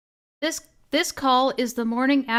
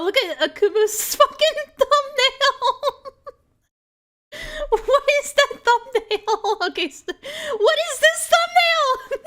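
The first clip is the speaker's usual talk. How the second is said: high, slow, laugh-choked disbelief; 14 semitones higher, 4 dB louder, slower than usual. Real, not acted. Streamer losing it over a friend's thumbnail, laughing. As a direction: crack up while reading it out.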